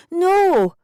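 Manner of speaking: high, loud shouted refusal; loud defiant shout; shouted back. A narrator's reading. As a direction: shout it back from a hiding place. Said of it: "I shouted back".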